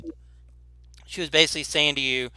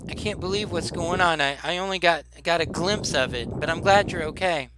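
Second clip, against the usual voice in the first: higher-pitched, halting relieved woman; relieved woman shopkeeper; 4 semitones higher than usual. Acted. Shopkeeper to the child after the blast, relieved. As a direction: stammer out relief in a lifted voice. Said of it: "so she says to you".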